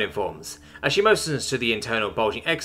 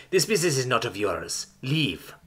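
terse, menacing creature voice; snapping back; 2 semitones higher, 4 dB quieter than usual. A narrator's reading. Creature warning off an intruder, snappish. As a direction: warn off the intruder curtly. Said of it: "they snap back".

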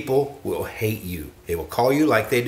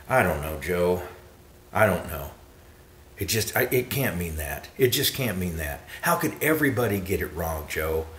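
low, soft mimicked doubter; doubting listener; 6 semitones lower, 5 dB quieter than usual. Doubters answering Joe about the scripture, imitated, doubtful. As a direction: imitate a hesitant, unconvinced listener, softly and low. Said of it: "this is a response I've heard many times".

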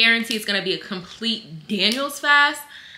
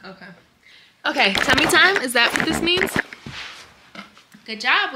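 normal talking voice

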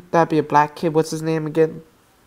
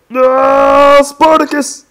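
loud, high, drawn-out shout; dramatic cry; 9 semitones higher, 8 dB louder, much slower than usual. Real, not acted. Player calling to Spartacus in a game, dramatic cry. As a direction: cry out as at a tragic loss.

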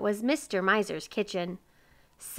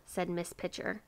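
usual speaking voice

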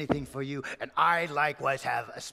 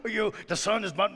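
rich man laughing, higher and louder; mocking laughter; 5 semitones higher, 4 dB louder than usual. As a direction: scoff at the farmer while laughing. Rich merchant mocks farmer.

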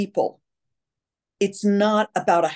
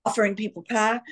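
usual speaking voice

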